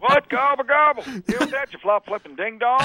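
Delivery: hammed-up Bush taunting Kerry; mock Bush voice. Bush taunts Kerry in debate, high mock voice.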